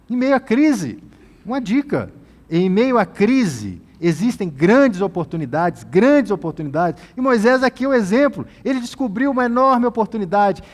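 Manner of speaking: fiery preaching; preaching at full force. Acted. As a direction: drive the point home to the church. Preaching climax.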